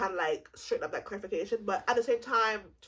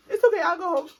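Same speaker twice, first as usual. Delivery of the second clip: mock resolute; 9 semitones higher, 9 dB louder, faster than usual. Repeating own decision, mock resolve.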